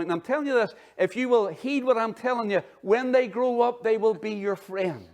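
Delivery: preacher driving the point to the congregation; fervent preaching. Acted. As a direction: raise it up into full pulpit force. Preaching at full force.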